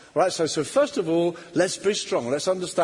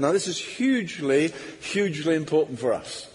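ordinary talk

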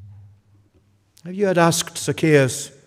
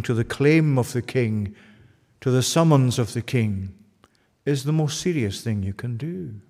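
ordinary delivery

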